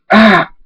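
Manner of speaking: short, high, loud yelp; sudden pained "ah"; cry of pain. Acted. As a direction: yelp as if hurt. Injured prince cries out.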